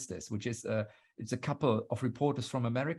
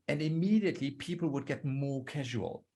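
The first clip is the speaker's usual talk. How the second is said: ordinary talk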